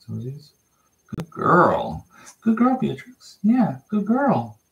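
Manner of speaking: high, drawn-out sing-song praise for a pup; puppy praise. Real, not acted. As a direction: stretch each word up high.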